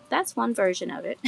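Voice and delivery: ordinary talk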